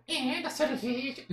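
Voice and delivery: high, soft, drawn-out puppet voice; slow high-pitched dummy; Kleber. Acted. Puppet adding to his answer, high puppet voice. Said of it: "acha uma voz que pareça com o boneco".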